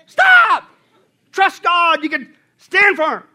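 sudden very high, loud shout; full-force preacher shout. Real, not acted. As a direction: stop the room dead with a yell.

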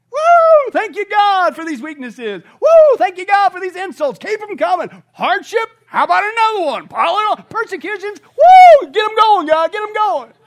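loud, high shouts of mock glee; hammed-up elated whooping. Paul thanks God for insults and hardships, hammed-up elated whooping. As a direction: whoop and cheer each one on.

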